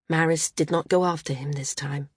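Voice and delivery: ordinary delivery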